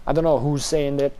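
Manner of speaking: ordinary talk, nothing marked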